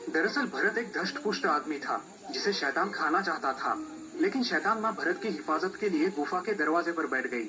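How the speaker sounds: ordinary delivery, nothing marked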